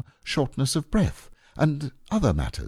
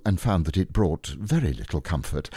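ordinary delivery, nothing marked

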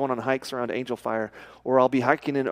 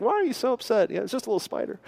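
imitating amused wife; 7 semitones higher, faster than usual. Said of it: "she's laughing at me like".

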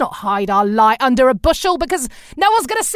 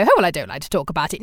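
fast, lower, self-effacing mock voice; coy British woman; 8 semitones lower, faster than usual. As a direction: brush off the praise like a shy Brit. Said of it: "be very British and very female and go".

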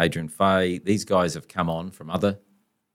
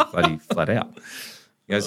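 normal talking voice

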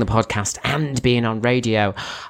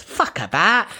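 Cockney trigger phrase; Cockney accent; 6 semitones higher, slower than usual. Shown as a demonstration. Comedian showing co-host a phrase that unlocks the accent, in Cockney. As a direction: say it in broad Cockney. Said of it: "to get into a kind of Cockney ac".

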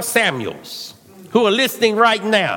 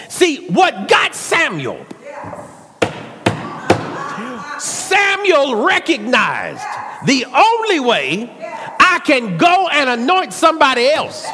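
preaching at full force; 6 semitones higher than usual. Acted. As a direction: build it to a shout. Preaching climax.

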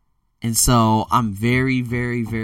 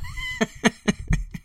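high real laughter. Real, not acted. Amused by mom's scolding, laughing. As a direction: laugh freely at your own story.

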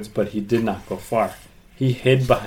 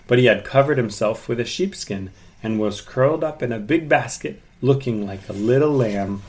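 usual speaking voice

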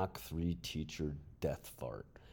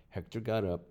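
ordinary delivery, nothing marked

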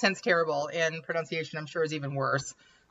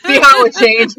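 laughing; 4 semitones higher, 10 dB louder than usual. Real, not acted.

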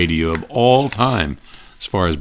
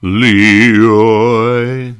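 Leroy stretched out, loud and higher; Gildersleeve scolding; 4 semitones higher, 8 dB louder than usual. Retold or imitated. Gildersleeve calling his nephew, pompous and loud. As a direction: drawl the name like a pompous uncle. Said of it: "you'd hear Gildersleeve say".

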